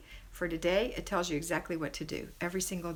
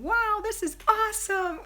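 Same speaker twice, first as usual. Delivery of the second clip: put-on kid yelling; 13 semitones higher, 6 dB louder than usual.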